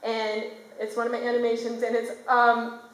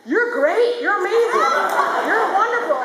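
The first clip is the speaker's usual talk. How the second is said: higher, louder, gushing praise; gushing parents; 10 semitones higher, 5 dB louder than usual. Retold or imitated. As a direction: gush like proud parents.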